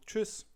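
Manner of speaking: Tschüss said high, the German way with a rounded ü. Shown as a demonstration. Teacher modelling Tschüss to learners, high and crisp. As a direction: pitch up and say Tschüss with a crisp tsch and short ü. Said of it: "you can say, for example".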